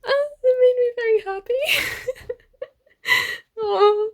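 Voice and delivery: overjoyed, laughing while near tears; laughing through tears. Real, not acted. Reader gushing to viewers about a happy moment, laughing tearfully. Emotional reading vlog, overjoyed.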